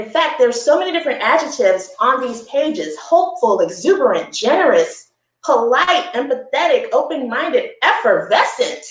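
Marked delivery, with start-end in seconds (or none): none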